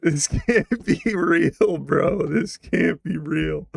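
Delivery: ordinary delivery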